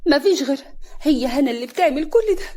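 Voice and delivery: acted furious accusation; angry. A narrator's reading. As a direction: blame her in loud anger. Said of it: "كريمة بغضب".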